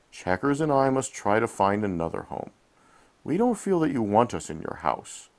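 ordinary delivery, nothing marked

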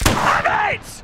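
very high drawn-out celebration yell; shout. Real, not acted. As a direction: yell it as you strike the ball. Shouts as he shoots.

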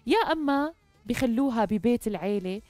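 ordinary delivery

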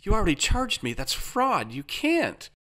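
high-pitched indignant accusation; worked-up, high-voiced objection; outraged protest. A narrator's reading. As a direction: accuse them in rising alarm. Outraged customer accuses company of fraud.

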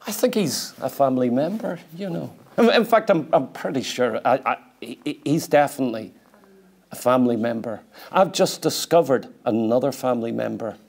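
softly, half to himself, amazed; quiet wonder. Real, not acted. Preacher marvels at meeting a brother, softly. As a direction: drop it low, marvelling.

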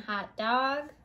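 usual speaking voice

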